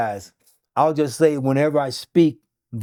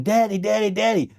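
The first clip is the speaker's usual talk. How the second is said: mild mock scream, pitched up; higher-pitched retold cry; 7 semitones higher than usual. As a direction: imitate her calling for Dad. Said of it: "and was screaming".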